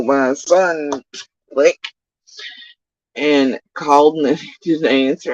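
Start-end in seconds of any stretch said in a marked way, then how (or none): none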